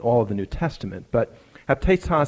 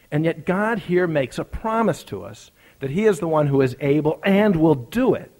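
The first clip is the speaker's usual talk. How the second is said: higher, louder preacher's voice, building to the climax; fervent preaching; 6 semitones higher, 4 dB louder than usual. Acted. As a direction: preach the story home with rising passion. Preaching at full force.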